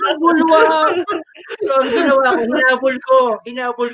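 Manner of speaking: laughing. Real, not acted. Laughing while retelling spill.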